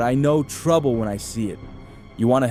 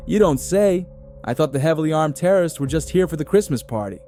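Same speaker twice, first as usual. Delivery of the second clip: dry sarcasm, higher, fast; mock the obvious, tough-cop style; sarcastic cop; 7 semitones higher, faster than usual. McClane sarcastic reply.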